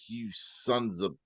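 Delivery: slow, soft, contemptuous snarl of an insult; quoting Tyrion's trial speech. Retold or imitated. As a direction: spit it out low and deliberate at the crowd. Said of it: "And I'll start quoting it".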